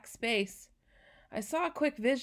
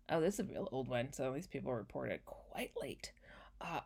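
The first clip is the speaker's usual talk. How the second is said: whispering; 9 semitones lower, 9 dB quieter than usual. Real, not acted. Aside while reading report.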